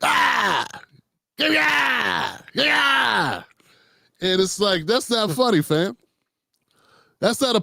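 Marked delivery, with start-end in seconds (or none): 0.0-3.4 high, hammed-up yelling; mock yell. Imitating Donnell yelling at the audience. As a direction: yell it like a comic with no punchline. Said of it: "so he just yells at you".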